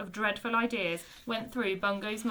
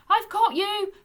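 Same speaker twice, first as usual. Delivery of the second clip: puffing; 10 semitones higher, 7 dB louder than usual. A narrator's reading. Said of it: "puffed Bungo".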